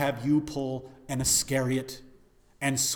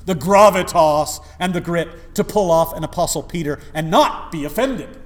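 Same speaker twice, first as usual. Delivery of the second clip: preaching at full force; 10 semitones higher, 15 dB louder than usual. Acted. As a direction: preach it like a revival climax. Preacher warns his flock.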